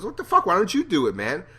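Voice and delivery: high, loud mock outburst; frustrated Shane. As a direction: vent at Rick for stalling. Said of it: "So he's, like".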